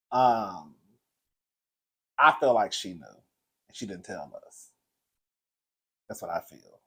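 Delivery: low, slow and increasingly soft; subdued grief. Real, not acted. Vlogger confiding that grandmother knew about cancer, subdued grief. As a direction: let it sink almost to nothing.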